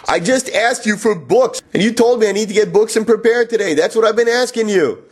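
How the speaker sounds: exasperated protest. Retold or imitated. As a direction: spell it out to someone who won't listen. Exasperated retort to the manager.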